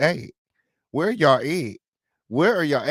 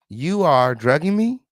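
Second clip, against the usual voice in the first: "You are drugging me?" lifted to a high rise on ME; questioning rise; 4 semitones higher, 4 dB louder than usual. Retold or imitated. Host reads a transcript's question mark aloud, questioning rise on ME. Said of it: "Is that a question".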